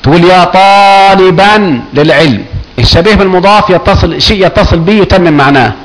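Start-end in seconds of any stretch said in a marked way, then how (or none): 0.2-2.5 very slow demonstration, "طالبا" ending in -an; sounded tanween. Shown as a demonstration. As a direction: stretch "طالبا" slowly to a clear -an. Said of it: "إذا كان شبيه بالمضاف".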